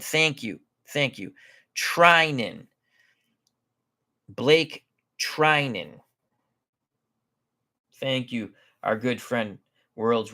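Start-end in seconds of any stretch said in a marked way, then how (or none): 1.8-2.7 Treinen said TRY-nen, with a long "i"; Treinen, first syllable like "try"; corrected pronunciation. Real, not acted. Repeats the corrected name. 4.3-5.9 Blake Treinen said slowly, surname as TRY-nen; slow, separated Blake Treinen, "try" in the surname; corrected pronunciation. Real, not acted. Practises the corrected name.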